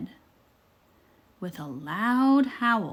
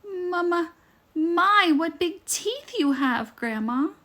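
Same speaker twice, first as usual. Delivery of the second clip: higher, louder, stammering in fear; frightened stutter; 9 semitones higher, 5 dB louder than usual. Acted. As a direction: stammer it out, scared of the teeth.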